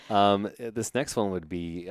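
normal talking voice

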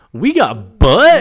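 loud, high, drawn-out crowing; hyped exclamation. Real, not acted. Reacting to nudity in the episode, hyped. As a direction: crow it slowly and loudly. Mock outrage over on-screen nudity.

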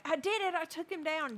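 high, excited mock boast; imitated bragging. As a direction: crow it like a proud little brother. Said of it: "he was bragging to his older brother".

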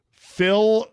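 high, loud name call; announcer call. Acted. Announcing listener name loudly.

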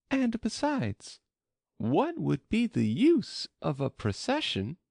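high-pitched Alice, questioning; lifted, high girl-character voice; Alice's voice. A narrator's reading. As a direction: voice Alice's thought well above the narration. Alice's thought voiced in narration.

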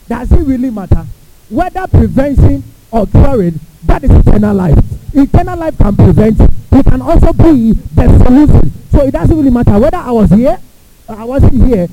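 loud, driving sermon voice; fiery preaching. Acted. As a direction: preach it out to the whole hall. Preaching at full force.

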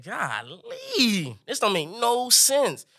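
mock-amazed complaint; exasperated disbelief. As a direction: stretch it out, incredulous and exasperated. Exasperated reaction to his brother.

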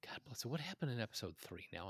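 very soft, whispered aside; muttering to oneself. Real, not acted. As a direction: mutter under your breath, trying to remember. Muttering to self while recalling.

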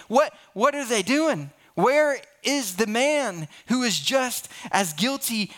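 high, baffled outrage; incredulous indignation. Real, not acted. Indignant at the missing man.

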